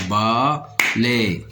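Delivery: "Balaye" as two drawn-out beats, ba, then laye; split into syllables. Shown as a demonstration. Teacher cutting a word for learners, "Balaye" syllable by syllable. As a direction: break "Balaye" with a clear pause after ba. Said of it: "On va découper les mots en syllabes".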